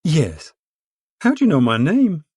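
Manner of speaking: flustered surprise at her name; caught off guard. A narrator's reading. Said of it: "as though she'd been caught off guard".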